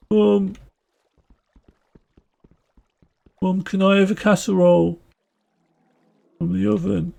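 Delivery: put-on high-pitched Brummie kid; Brummie accent. Child asking mum for a casserole, high Brummie accent. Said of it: "doing a Brummie accent asking for".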